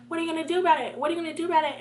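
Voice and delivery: high mimicked taunt, said twice; challenging classmate. Classmate challenging student who went off, high taunting imitation. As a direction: repeat her dare in a high voice. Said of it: "she's like".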